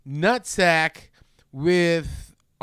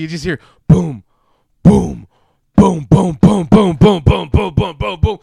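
louder repeated boom sound effects; 12 dB louder than usual. Retold or imitated. Host imitating the booming in the tissue factory, loud. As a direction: pound out each boom.

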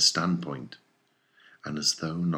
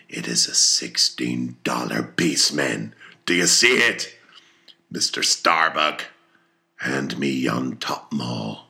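lower, slow, louder orders; commanding captain; 5 semitones lower, 4 dB louder than usual. A narrator's reading. Captain shows the crew the gold and orders Starbuck. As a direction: weigh each word like an order.

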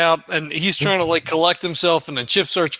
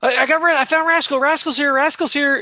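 soothing; 9 semitones higher than usual. Acted. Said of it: "he's like".

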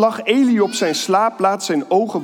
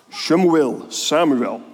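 ordinary talk, nothing marked

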